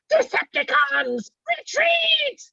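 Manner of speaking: very high screamy robot shriek; classic Starscream. Retold or imitated. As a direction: screech the order like the cartoon Starscream, slow and shrill. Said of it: "Very high-pitched, very screamy".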